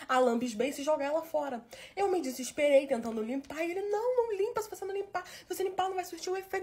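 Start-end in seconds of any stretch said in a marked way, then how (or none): 3.8-6.6 higher-pitched imitation of the boyfriend urging; boyfriend pleading.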